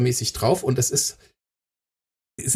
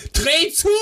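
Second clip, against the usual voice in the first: sharp shouted order; shouting; 11 semitones higher than usual. Retold or imitated. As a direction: yell it at her to close it. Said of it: "Ich guck sie an und sag".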